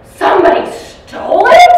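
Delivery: slow, very high, loud disbelieving question; shocked echo. Retold or imitated. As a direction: mirror her outrage back at her. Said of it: "stands up and goes".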